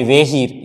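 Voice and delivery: "वेहीर" starting with ve for vi; mispronunciation demo. Shown as a demonstration. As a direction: open "वेहीर" on a long e vowel. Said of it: "ये तो गलत ही है".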